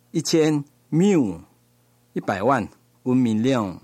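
ordinary talk

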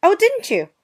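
high, loud mock-surprised jab; sarcastic. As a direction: feign surprise to wound him. Sarcastic retort.

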